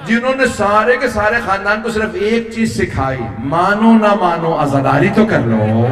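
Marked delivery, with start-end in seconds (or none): 3.4-5.9 slow preacher's entreaty, sinking in pitch; drawn-out plea. Retold or imitated. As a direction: stretch each phrase out as an earnest exhortation. Preacher voices the woman's plea.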